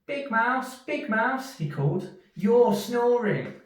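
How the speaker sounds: high, slow complaining mouse; Little Mouse. Acted. Little Mouse wakes Big Mouse about snoring: high slow complaint. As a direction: drag out the complaint like a tired little one.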